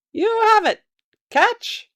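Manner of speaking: put-on very high old croaker; ancient croaking voice. A narrator's reading. Old trader agreeing to a swap of bones, high croak. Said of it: "came an ancient croaking voice from nearby".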